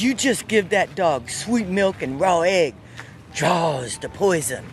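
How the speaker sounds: lightly voiced old Southern man, slowing at the end; a hint of a backwoods Florida man giving a remedy. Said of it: "I have to speak like him".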